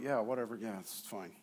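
very low, soft, half-asleep mumble; sleepy brush-off. Retold or imitated. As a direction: mumble it with your eyes shut. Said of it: "I'll be like".

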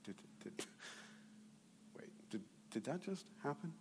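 whispered, dazed disbelief; stunned whisper. Retold or imitated. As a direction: whisper it in shock. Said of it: "they're like".

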